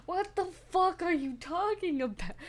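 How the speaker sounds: high, incredulous exclamation; shocked, high-pitched what; baffled outburst. Real, not acted. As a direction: cry out in total confusion. Baffled by game dialogue.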